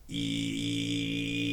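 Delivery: low throat tone with a whistle on top; throat whistling. Shown as a demonstration. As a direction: whistle it through the back of the throat. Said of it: "it's a high-pitched squeal and your low pitch".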